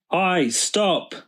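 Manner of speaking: high call to stop a thief; shouted. A narrator's reading. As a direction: call out sharply after the man. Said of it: "Daddy shouted".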